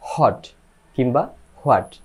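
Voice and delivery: হট and হোয়াট both with a sounded h; two mispronunciations. Shown as a demonstration. Teacher shows learners wrong versions, h-initial হট and হোয়াট. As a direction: say হট as "hot" and হোয়াট as "ho-at". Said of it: "কোনোটাই সঠিক নয়".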